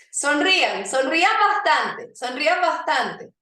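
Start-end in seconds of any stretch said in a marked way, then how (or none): none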